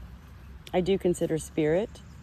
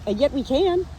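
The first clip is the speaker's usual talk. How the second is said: higher and louder, with conviction; emphatic lift; 8 semitones higher, 6 dB louder than usual. Real, not acted. Talking to viewers about feeling, higher and louder with conviction. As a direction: turn it around with a bold lift. Emphatic turn after pause.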